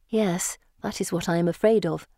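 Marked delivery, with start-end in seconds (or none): none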